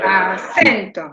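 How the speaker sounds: "Acento" slowly, with CEN punched; drawn-out "Acento" peaking on CEN; stress on middle syllable. Shown as a demonstration. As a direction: hold back A, then hit CEN in "Acento". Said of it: "¿Cuál es la sílaba tónica?".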